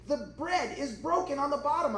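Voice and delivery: lightly imitated kid's whine; whining child. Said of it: "he starts whining and literally crying".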